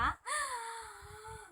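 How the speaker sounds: sharp, high gasp of shock. Acted. Seller hearing the last hook is used, gasping in shock. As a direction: gasp as if the hook just broke.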